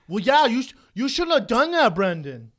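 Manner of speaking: mock Wisconsin accent. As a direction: imitate a Wisconsin local scolding. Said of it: "Why do they talk like that?".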